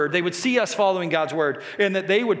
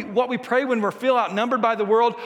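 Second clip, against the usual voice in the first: ordinary talk, nothing marked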